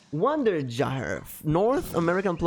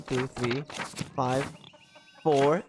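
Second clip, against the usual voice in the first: ordinary delivery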